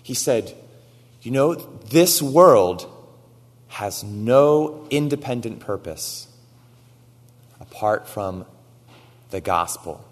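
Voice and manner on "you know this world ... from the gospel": loud, slow, every word weighted; quoting the teacher slowly and loudly for weight; slow emphatic quote. Retold or imitated. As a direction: lay down each word like a verdict. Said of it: "one thing that he said that really struck with me".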